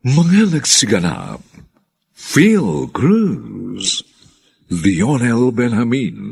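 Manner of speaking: ordinary talk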